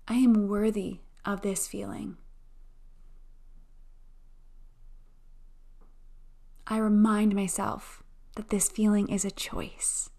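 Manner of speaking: slow, calm affirmation voice; guided affirmations. Acted. Guided affirmation and breathing meditation.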